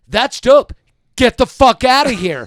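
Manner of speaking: loud, high shout telling her off; retold shout. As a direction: yell it across the restaurant table. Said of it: "I was like".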